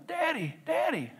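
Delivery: high, soft imitation of a small boy; little child's voice. Little boy tugging a pant leg calls his father, in a small high voice. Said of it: "I hear this little voice going".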